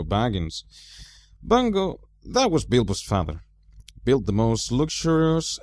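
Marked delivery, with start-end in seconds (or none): none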